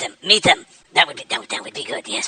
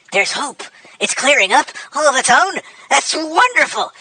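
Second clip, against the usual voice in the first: delighted relief; 9 semitones higher, 3 dB louder than usual. Acted. Relief when stream problem clears.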